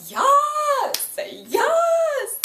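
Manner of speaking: high, loud whoops of joy, far above her normal pitch; excited shout. Retold or imitated. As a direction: cheer like your ship just sailed. Cheering at Magnus's line.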